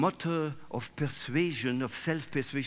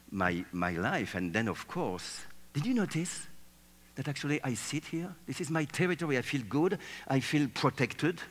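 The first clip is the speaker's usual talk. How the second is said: normal talking voice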